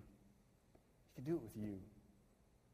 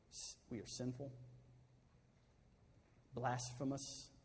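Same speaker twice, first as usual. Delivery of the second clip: closing prayer. Acted. Pastor leading the church in closing prayer, hushed and reverent. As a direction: pray humbly over the people.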